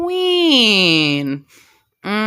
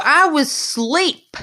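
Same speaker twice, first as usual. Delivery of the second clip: pleading; 9 semitones higher, slower than usual. Retold or imitated. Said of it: "Sleeping Beauty tries to tell her".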